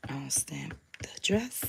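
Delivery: whispered aside to herself; a soft whispered reminder to herself. Real, not acted. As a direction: breathe it out under her breath. Muttering to herself mid-task.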